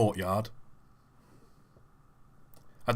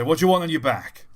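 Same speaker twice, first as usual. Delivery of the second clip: lightly acted, raised official voice; questioning official; 5 semitones higher, 6 dB louder than usual. Official asking a prisoner his choice, lightly acted loud voice. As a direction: put the question loudly, pitched up. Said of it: "And I say".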